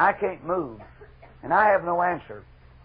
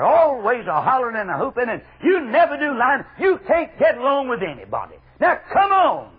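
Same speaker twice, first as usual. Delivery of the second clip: raised, high-pitched hectoring; mock scolding; 7 semitones higher, 6 dB louder than usual. Preacher as the ministers berating Jeremiah, mock scolding. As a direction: scold the troublemaker, climbing to a loud finish.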